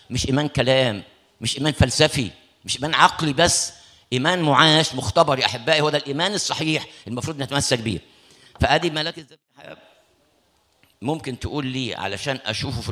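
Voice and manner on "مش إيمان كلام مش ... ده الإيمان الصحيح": building, louder preaching climax. Acted. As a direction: drive the point home to the congregation. (وعظ متصاعد بصوت أعلى)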